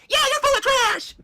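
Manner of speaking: very high drawn-out squeal; kids jeering. Acted. Kids agreeing with Big Bird, slow high squeal. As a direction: yell it like a crowd of children. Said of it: "Big Bird and the kids".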